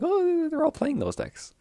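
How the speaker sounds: slow, very high, soft mocking voice; 1 mocking squeaky voice. Streamer mocking his own discovery that everyone plays those decks, squeaky mock voice. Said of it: "And then I go online, like".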